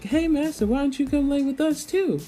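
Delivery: hammed-up high girl asking master to join; Philo voice. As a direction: squeak it out like a little bird-girl. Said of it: "Philo's like".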